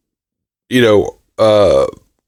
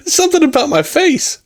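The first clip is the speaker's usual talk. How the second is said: high-pitched, laughing through the words. Real, not acted. Married man joking about his own looks, laughing. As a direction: let real laughter lift the line.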